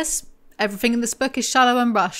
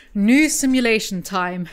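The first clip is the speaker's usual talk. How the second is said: usual speaking voice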